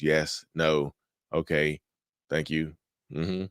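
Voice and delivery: low, soft, emotionless replies; bland answers. Shown as a demonstration. Coach shows gray rock replies to a narcissist, bland. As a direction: give each short answer with no interest, quietly. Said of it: "giving them bland answers".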